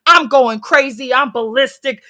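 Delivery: normal talking voice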